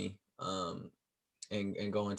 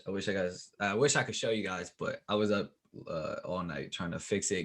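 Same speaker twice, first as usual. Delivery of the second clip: ordinary talk, nothing marked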